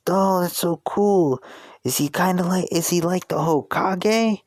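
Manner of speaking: put-on high-pitched, wide-eyed boy voice; Naruto. Kid marvels at the pirate's story, high eager boy voice. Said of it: "Naruto would be like".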